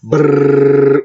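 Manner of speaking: rolled lips drum roll. Pretend. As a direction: make a drum roll with your mouth. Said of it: "Drum roll, please".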